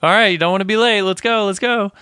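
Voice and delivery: quick, high-pitched imitation of shooing; hurrying them out. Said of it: "she kinda hurries them out the door".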